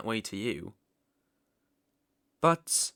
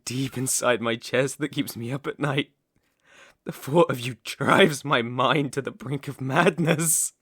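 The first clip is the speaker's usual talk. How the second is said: louder and louder, feverish devotion; obsessive rising rant; 3 semitones higher, 6 dB louder than usual. Acted. Villain explaining his crimes to princess, growing manic fervour. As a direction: build into unhinged obsession.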